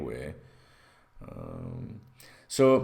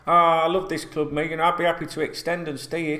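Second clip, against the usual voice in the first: higher, louder mock-sincere footballer; a fake heartfelt pledge from the player; 6 semitones higher, 5 dB louder than usual. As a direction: ham up the loyal player's promise. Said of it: "What's he do?".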